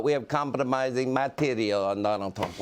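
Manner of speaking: mock Russian accent, prank caller; hammed-up Russian accent, heavy and foreign. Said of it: "in a Russian accent".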